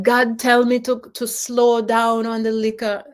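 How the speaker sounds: imitating a Jamaican father; attempted Jamaican accent. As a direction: try Dad's Jamaican lilt. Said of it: "see if I can do his accent".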